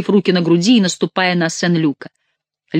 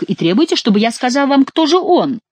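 exasperated protest climbing high at the end; indignant protest; 8 semitones higher, 4 dB louder than usual. A narrator's reading. Saint-Luc objects to naming the culprit, indignant protest. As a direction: push the complaint upward in pitch. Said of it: "запротестовал Сен-Люк".

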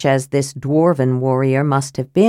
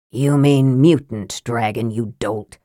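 low, cold sneer at his brother; a lightly voiced cold wizard; cold Raistlin; 7 semitones lower than usual. A narrator's reading. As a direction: cut him down icily. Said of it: "Raistlin said coldly".

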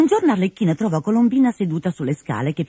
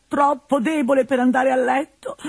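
put-on whining old man; 9 semitones higher than usual. Pantalone complaining.

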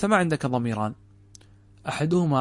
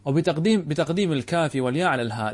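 normal talking voice

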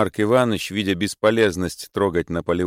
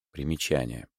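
usual speaking voice

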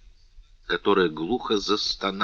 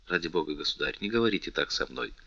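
much softer, lower; hushed plea; 4 semitones lower, 10 dB quieter, faster than usual. A narrator's reading. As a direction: beg him quietly to stop. Louise begs him to stop.

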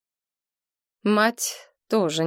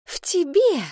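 sudden much higher exclamation; seizing on the words; 11 semitones higher than usual. A narrator's reading. Mother catching her daughter's words, sharply higher. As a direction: pounce on the words. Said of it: "ухватилась мать за её слова".

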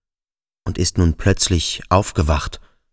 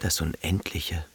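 much softer, an inward echo of the word; echoed softly; 2 semitones lower, 14 dB quieter than usual. A narrator's reading. Thales repeating the word to himself, softly. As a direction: let "Das Unendliche" fall almost to nothing.

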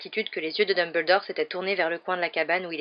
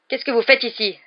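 furious; 5 semitones higher, 7 dB louder than usual. A narrator's reading. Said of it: "s'exclama Hagrid avec fureur".

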